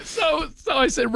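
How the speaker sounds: high, slow laughing speech. Real, not acted. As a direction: laugh through the words. Teller laughing.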